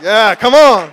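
loud, high shout of agreement; retold elation. Man hearing the song from his boss, excited shout. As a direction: cheer it out at full volume.

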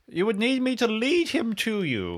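put-on high, theatrical old eccentric; eccentric old Winston. Explaining how to reach Sir Butterscotch, high eccentric voice.

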